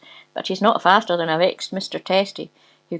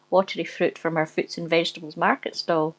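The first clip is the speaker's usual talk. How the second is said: ordinary delivery, nothing marked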